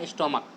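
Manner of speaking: स्टोमक ending on a clear क; pronounced with k. Shown as a demonstration. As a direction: correct it to स्टोमक with क. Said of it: "नहीं स्टोमच नहीं होता". (स्टोमक, च नहीं, क बोलें)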